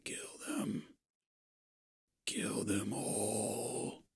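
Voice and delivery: slow, whispered then low menacing command; sinister voice in head. Acted. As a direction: creep into his mind, slow and low. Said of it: "the voice of the Wicker in your head going".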